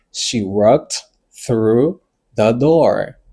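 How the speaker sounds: word by word, gaps between the words; very slow model. Shown as a demonstration. Teacher giving learners an example, very slow and separated. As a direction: dictate it very slowly for learners.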